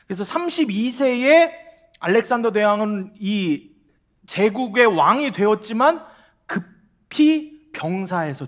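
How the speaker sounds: slow, loud, emphatic preaching; preaching at full force. Real, not acted. Preacher proclaiming to the congregation, slow and loud. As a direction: drive home each word from the pulpit. Preaching climax on Alexander's rise.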